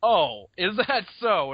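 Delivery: slow, high, loud sarcastic comeback; mock challenge. T-Rex answering the juvenile's claim, mock challenge. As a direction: draw it out loudly, daring the upstart. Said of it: "And the T-Rex is like".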